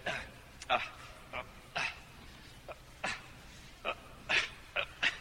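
halting, quiet "uh"s with long gaps; imitated hesitant stammer. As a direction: mimic someone struggling to answer. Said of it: "And this is what she said".

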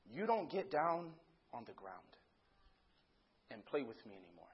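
put-on soft, low retelling of a child's plea; gently hurt. Daughter telling daddy he no longer plays, softly hurt. Little girl's hurt answer, retold.

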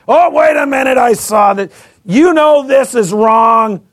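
loud, high, accusing shout; mock accuser. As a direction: play the nosy judge catching you out. Mocking an obnoxious accuser.

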